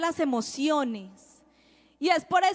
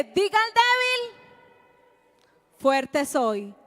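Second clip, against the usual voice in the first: raised, emphatic preaching; preaching the verse; 4 semitones higher, slower than usual. Acted. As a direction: proclaim the verse with conviction. Predicador citando la Biblia. (predicando con fuerza)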